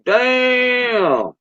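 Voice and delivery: a high, drawn-out amazed damn; stunned damn. Retold or imitated. Reacting to Australian crawfish as big as lobsters, amazed. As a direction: gasp it out in disbelief at giant crawfish. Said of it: "I'm like".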